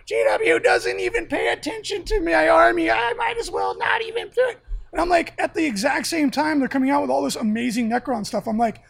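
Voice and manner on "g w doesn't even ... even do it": whiny complainer. Retold or imitated. Mocking whiny gamer complaint.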